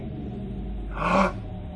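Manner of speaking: soft sharp intake of breath; shocked gasp. A narrator's reading. Recognising the old lady as grandmother, shocked gasp.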